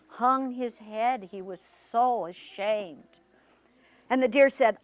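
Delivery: ordinary delivery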